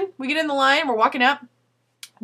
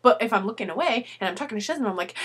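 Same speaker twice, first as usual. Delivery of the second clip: ordinary talk, nothing marked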